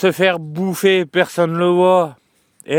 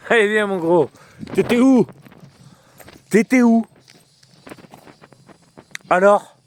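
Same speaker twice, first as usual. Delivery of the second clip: coaxing the dog; 5 semitones higher than usual. Real, not acted.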